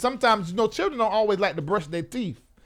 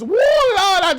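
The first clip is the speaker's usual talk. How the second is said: high, loud, slow joyful woo Lord; 11 semitones higher, 8 dB louder, slower than usual. Real, not acted. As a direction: burst out with delight. Relishing hugging parents.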